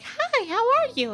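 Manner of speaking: high, chirpy customer-service greeting. Acted. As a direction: put on a fake cheerful customer service voice. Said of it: "it's, like, the customer service voice".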